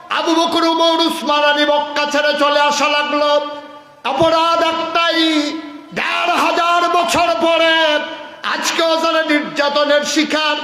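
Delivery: high, loud sustained chant with drawn line endings; full-force preaching. Real, not acted. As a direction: proclaim the injustice to the crowd at full cry.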